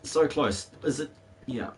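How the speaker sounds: ordinary delivery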